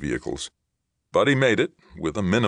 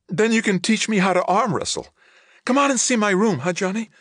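put-on very high, louder excited child voice; panting little girl; 6 dB louder than usual. A narrator's reading. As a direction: squeal it out like an eager little sister. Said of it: "she panted".

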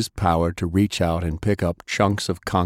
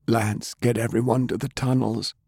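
weak commander; 4 semitones higher than usual. Acted. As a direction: give the order through weakness. Browning orders.

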